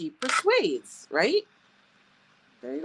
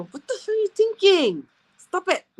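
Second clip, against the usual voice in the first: high, loud mock scold of the kids; 10 semitones higher, 6 dB louder than usual. Mom scolding her kids in mock anger. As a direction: play the exasperated mom catching them.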